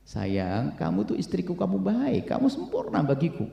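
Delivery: soft, coaxing reassurance; tender husband. Acted. Husband telling his wife she is perfect, tender and soft. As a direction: soothe her lovingly, hushed.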